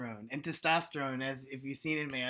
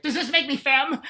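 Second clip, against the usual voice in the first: high, loud, worried little question; anxious butch; 10 semitones higher, 11 dB louder than usual. Retold or imitated. Butch wondering during sex, high mock worry. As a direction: fret it in a put-on fluttery voice. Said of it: "you're always like".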